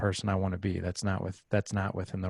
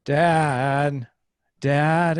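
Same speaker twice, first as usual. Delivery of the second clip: higher, louder drawn-out mock call; imitated child calling out; 6 semitones higher, 7 dB louder, much slower than usual. Six-year-old calling Dad from his room, insistent imitated call. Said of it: "I heard my six-year-old say".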